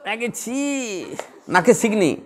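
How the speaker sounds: very slow, drawn-out disgusted "ছি"; mock disgust. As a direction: sneer it out as an ordinary person would. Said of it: "এ বলল". (নাক সিঁটকে ধীরে বলা)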